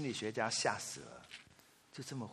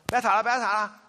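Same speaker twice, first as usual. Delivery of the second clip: raised scolding voice, louder; mother scolds fighting daughters, raised voice; wife scolding; 7 semitones higher, 8 dB louder than usual. Retold or imitated. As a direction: tell the girls to stop squabbling. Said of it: "然后我太太就说".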